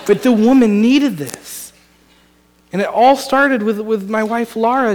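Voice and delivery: normal talking voice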